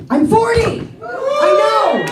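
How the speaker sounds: forty shouted up high; celebrating hitting forty; excited proclaim. Real, not acted. Comic telling the crowd she is forty, shouting it excitedly. Announcing her age.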